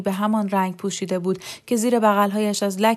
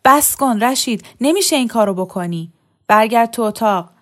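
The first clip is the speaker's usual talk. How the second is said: shouting to stop him; 3 semitones higher, 6 dB louder than usual. A narrator's reading. Young wife shouting at husband to stop. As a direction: order him to stop, loudly. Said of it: "دختره داد زد".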